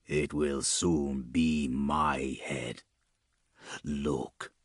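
low, slow, soft menacing voice; sinister knight. A narrator's reading. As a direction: speak low and slow, ominous. Chaos Knight speaks.

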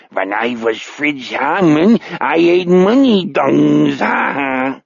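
slow, gloating alien German; eerie mock-German boast with a laugh; extraterrestrial German accent. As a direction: burst into a drawn-out pseudo-German voice. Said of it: "extraterrestrial version of a German accent burst out".

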